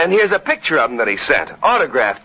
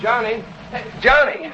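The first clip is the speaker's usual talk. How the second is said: calling out; 10 semitones higher than usual. Acted. Brother calling to boy.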